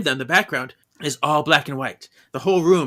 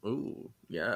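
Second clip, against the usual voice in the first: vain Gaston purr; 4 semitones lower, 14 dB quieter than usual. Acted. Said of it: "in the mirror like Gaston".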